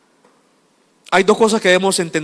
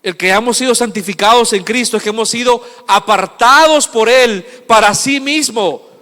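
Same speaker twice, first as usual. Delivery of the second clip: loud, higher pulpit declaration; emphatic preaching; 6 semitones higher, 9 dB louder than usual. Real, not acted. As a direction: declare it forcefully, slowing at the end.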